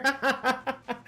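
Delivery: quick high laugh; real laugh. Real, not acted. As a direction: laugh at the memory. Laughing at own story.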